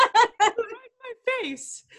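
high, slow laughing disbelief. Real, not acted. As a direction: laugh through it in shock. Bug flew in face, laughing.

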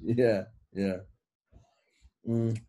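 talking while chewing; murmured through a mouthful of food; mouth full. Real, not acted. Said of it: "Sorry, I'm eating".

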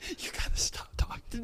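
breathless whispered plea. Real, not acted. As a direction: whisper it, barely voiced. Begging friend to stop.

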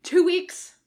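loud, high grudging answer; grudging Bones. A narrator's reading. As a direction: admit it reluctantly.

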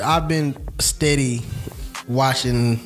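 ordinary talk

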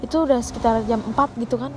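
ordinary delivery

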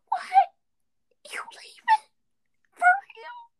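very high, squeaky disbelief; tiny high-pitched incredulous gasps; hammed-up shock. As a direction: squeak each question in mock astonishment. Acts out own shock at news.

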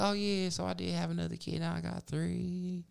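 put-on high, soft girly voice, slowing at the end; imitating her. Caller telling Mike she has three kids, high soft imitation. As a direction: drag out the last words in her high voice. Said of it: "She's like".

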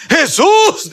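high, loud call of the name; acted shout. Blind man calling to Jesus in the crowd, high shout. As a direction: shout to be noticed over a crowd. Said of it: "pegaba de gritos".

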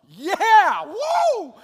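high, loud whoops of delight; wildly excited. Retold or imitated. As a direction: shout it like the best news of your life. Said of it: "I got so stinging excited".

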